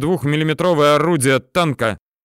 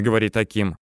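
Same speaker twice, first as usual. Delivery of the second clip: usual speaking voice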